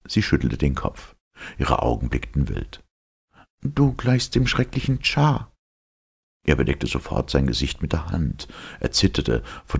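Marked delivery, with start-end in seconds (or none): 3.6-5.5 high, fearful heroine voice; shrinking away in fear; frightened young woman. Acted. Elita frightened. (ängstliche, hohe Frauenstimme)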